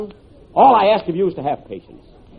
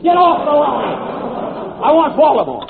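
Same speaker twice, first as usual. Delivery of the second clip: annoyed; 4 semitones higher than usual. Acted. Crossed line, annoyed caller.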